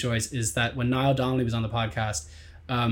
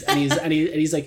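normal talking voice

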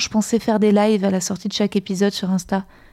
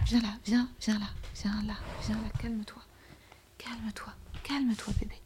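whispering; 16 dB quieter than usual. Acted. Soothing someone in a hushed voice.

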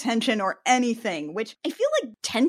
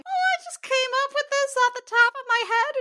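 put-on high innocent child voice; 14 semitones higher than usual. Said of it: "he's like".

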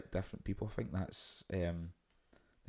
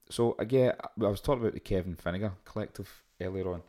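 usual speaking voice

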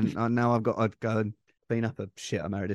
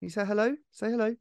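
singsong puppet show host; 8 semitones higher, faster than usual. Real, not acted. Coaxing baby to say hello.

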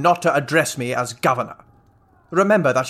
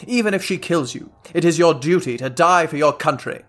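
ordinary talk, nothing marked

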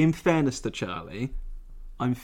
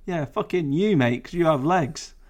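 ordinary talk, nothing marked

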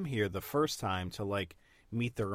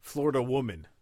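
ordinary talk, nothing marked

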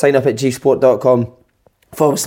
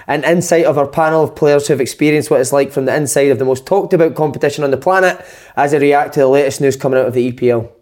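upbeat presenter read, a touch higher; read-out show intro; 4 semitones higher than usual. Acted. Host opening the podcast, scripted presenter read. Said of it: "I'd read that off a".

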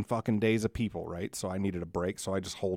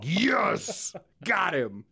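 gleeful cheer; 8 semitones higher, much slower than usual. Retold or imitated.

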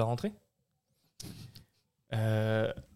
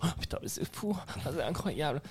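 fake amazement; 4 semitones higher, 5 dB quieter than usual. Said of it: "avec cette voix-là".

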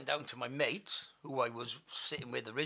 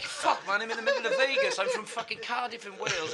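loud, high, laughing protest; mock exasperation; 8 semitones higher, 9 dB louder than usual. Cardiff man recognised in Vegas, loud mock exasperation. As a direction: wail it in hammed-up disbelief.

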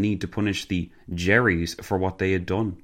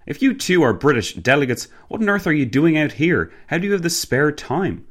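higher-pitched, louder questioning; puzzled young delegate; 8 semitones higher, 4 dB louder than usual. Acted. Passenger questioning two British delegates on their outing, puzzled. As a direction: press them in bewilderment.

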